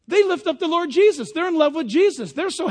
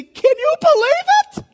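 high, loud, astonished mock outburst; amazed exclamation; 13 semitones higher, 7 dB louder than usual. Preacher voicing a disciple bursting out in amazement. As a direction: gush like a stunned disciple. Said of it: "that you would say".

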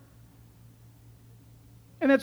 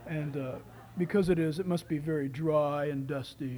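low, dull and dry; dry bored voice; 8 semitones lower, 5 dB quieter than usual. Pretend. A stiff Jesus musing on truth, low bored drone. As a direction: drone it like a stuffy scholar. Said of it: "he sits and goes".

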